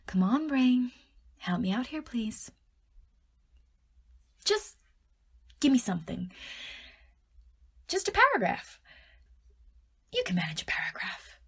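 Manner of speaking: pleading, wheedling your own brain with sudden high jumps; coaxing. Pretend. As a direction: cajole a stubborn pet into performing.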